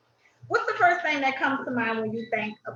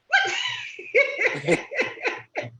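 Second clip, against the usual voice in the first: high laugh; 3 dB louder than usual. Real, not acted. Notices a dog.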